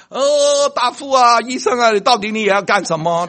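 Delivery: high, loud frightened cry; alarmed patient. Acted. Patient crying out to the doctor in loud alarm. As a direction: panic at the sight of the needle.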